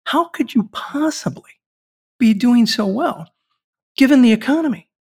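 incredulous question. Retold or imitated. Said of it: "I said".

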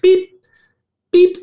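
high electronic beep, beep; beeping heart monitor. Retold or imitated. Coach explaining the body's electricity, imitating a heart monitor beep. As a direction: mimic the EKG machine's beep.

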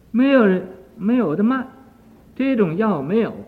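loud despairing outburst; loud lament. Acted. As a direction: cry out that nobody sells it. Weeping son tells of missing medicine.

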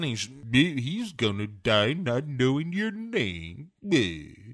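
slow, drawn-out Droopy-like villain; Blofeld. Acted. Blofeld answering Madeline about the torture, slow villain impression. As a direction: drag the words in a cartoon villain voice. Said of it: "sounds a little like Droopy Dog".

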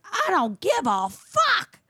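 high, slow mocking drawl of a defiant girl; mocking student. Quoting the defiant student to the group, high and slow. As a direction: stretch it out high like a sullen teen. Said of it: "She goes".

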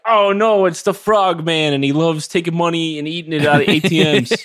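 panicked witness. Pretend. Mock news eyewitness panic.

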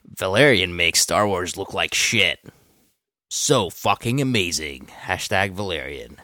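low, slow tweet reading; Will Arnett. Acted. Co-host reads a fan's tweet aloud, lightly impersonated actor voice. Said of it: "Will Arnett voice".

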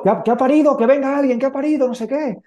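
orderly bursting in, shouting high for help; imitated yell. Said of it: "y gritó".

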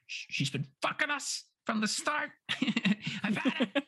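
high, laughing exasperated rant; fed-up Eve. Retold or imitated. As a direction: play Eve at her wits' end. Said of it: "Eve is like".

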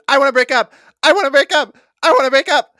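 high, loud panicked yells, repeated; imitated screaming. Man screams to break up mid-ride, panicked shout. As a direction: yell it out like a desperate rider. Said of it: "at one point he screams".

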